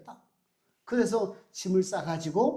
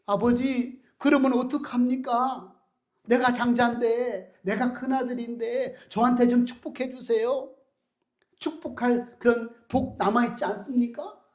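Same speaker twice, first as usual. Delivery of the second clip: higher and louder, begging his father; desperate pleading; 4 semitones higher, 4 dB louder than usual. Acted. Esau begging his father for any blessing left, higher and louder. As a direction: plead like a cheated eldest son.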